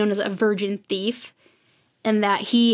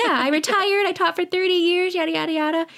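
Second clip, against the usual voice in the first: put-on higher, chirpy sweet old lady voice; 8 semitones higher than usual. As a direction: play the cheerful retired teacher, pitched up. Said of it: "And she's like".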